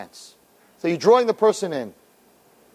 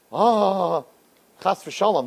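usual speaking voice

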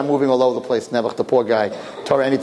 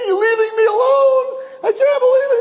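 screaming; 3 dB louder than usual. Acted. Twin left alone in the womb, hammed-up tearful screaming. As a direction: wail it like you're being abandoned. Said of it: "He's screaming".

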